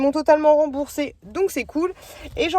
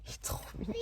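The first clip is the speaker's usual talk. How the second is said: breathy whisper, barely voiced; whispered aside; 19 dB quieter, faster than usual. Real, not acted. Mother whispers to viewers about her cute son. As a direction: let it slip out under your breath.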